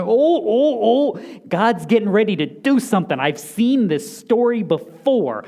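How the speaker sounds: sudden excitement at spotting the pattern; excited realization. Retold or imitated. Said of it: "your brain should be going".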